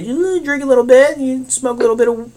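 high voice, easing down step by step; mock coaxing. Acts escalating self-persuasion.

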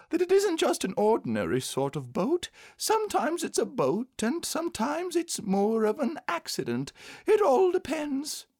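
very high-pitched bear voice; small bear carefully explaining, high; earnest little bear. A narrator's reading.

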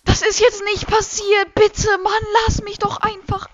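loud, high pleading rant; frustrated yelling. Real, not acted. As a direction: yell at the game in frustration. Fails the bridge, pleads loudly.

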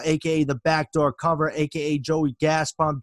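ordinary delivery

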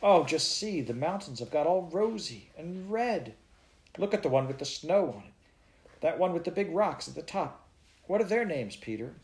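put-on eager child pointing out the peaks; excited little girl. A narrator's reading. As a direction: gasp at the red mountains. Child voice of Heidi.

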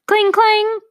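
high, bright sing-song bell imitation; chiming cling clang; bell chime. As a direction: ring it out like a shop cowbell. Cowbell rings at the door.

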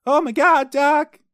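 very high, loud alarmed cry; hammed-up panicked movie hero; Marty McFly. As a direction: yell it high in mock shock. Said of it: "Michael J. Fox".

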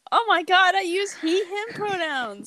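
high, chirpy fake friendly voice; overly bubbly fake tone. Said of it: "Why do you sound so fake?".

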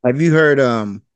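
ordinary talk

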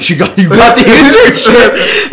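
very high, loud, drawn-out disbelief; astonished squeal. Retold or imitated. Girlfriend hears of the internship, squealing in amazement. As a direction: imitate a squealing, overjoyed girlfriend.

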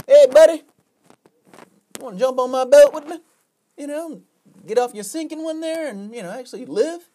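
high, friendly boater's hail; calling out. Acted. Passing boater invites a sinking man aboard, calling out. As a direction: hail him loudly, then pitch the offer high.

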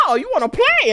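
high, cocky challenge; taunting bird. Pretend. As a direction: taunt like a bird picking a fight.

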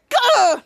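loud high "gah"; frustrated outburst. Acted. Captain Man after being hung up on, loud frustrated gah. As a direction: blurt out in frustration.

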